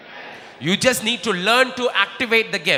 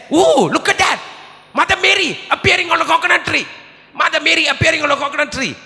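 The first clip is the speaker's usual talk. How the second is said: excited crowd; 7 semitones higher, faster than usual. Retold or imitated. Villagers marvel at Mary on a coconut tree, excited. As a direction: gush like an amazed onlooker. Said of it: "And they said".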